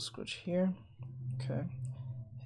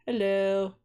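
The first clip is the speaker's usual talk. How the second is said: ordinary talk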